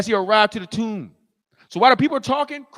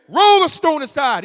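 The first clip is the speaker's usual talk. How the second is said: loud, high angry order; mad command; 6 semitones higher, 7 dB louder than usual. Acted. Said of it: "Christ still mad".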